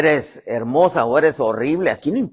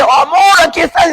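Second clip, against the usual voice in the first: loud, high, overjoyed cry; hammed-up delighted wife calling out; joyful greeting; 12 dB louder than usual. Wife running to her husband in heaven, joyful greeting.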